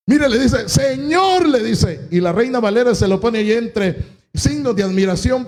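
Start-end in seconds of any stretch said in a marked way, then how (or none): 0.7-1.5 high-pitched call to the Lord; acted cry for help. Sinking Peter calling to Jesus, acted cry. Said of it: "en ese grito".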